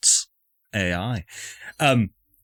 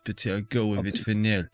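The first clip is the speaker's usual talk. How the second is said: normal talking voice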